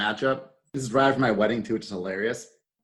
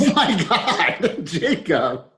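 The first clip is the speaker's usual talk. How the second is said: laughing; 5 semitones higher, much slower than usual. Real, not acted. Hearing Jacob was the saboteur.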